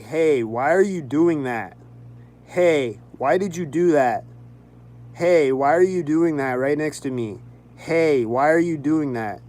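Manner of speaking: loud, slow demanding questions to strangers; retold loud confronting. To passing drivers, a loud slowed demand. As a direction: call out to them, demanding.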